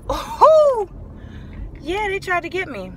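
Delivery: high, loud knowing ooh; imitating catching them out; mock realization. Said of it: "I'm like".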